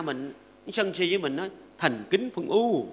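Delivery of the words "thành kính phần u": ironic condolence. Pretend. Mock condolence to angry spouse.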